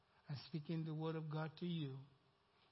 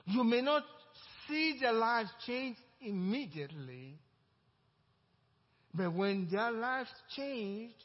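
preaching; 6 semitones higher, 8 dB louder, slower than usual. Acted. Preacher driving home a point.

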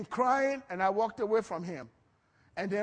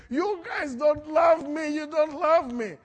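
loud, high, drawn-out wail of a tantrum; mock crying protest; 7 semitones higher, 6 dB louder than usual. Upset son accusing his parents of not loving him, mock crying protest. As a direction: imitate a child's loud, tearful accusation. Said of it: "he started crying and beating everything".

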